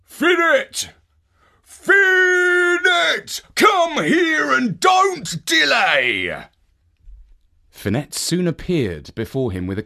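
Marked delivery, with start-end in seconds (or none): 0.0-6.5 loud, high, drawn-out call; giant bellowing. Acted. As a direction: call her across the house. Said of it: "called out".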